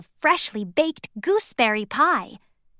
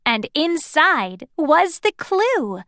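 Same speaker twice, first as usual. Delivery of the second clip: usual speaking voice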